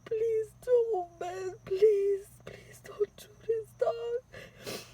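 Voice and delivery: high, tearful begging; crying plea. Retold or imitated. Begging the gunman to spare them, high crying voice. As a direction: plead with the robber through tears. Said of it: "I kept saying".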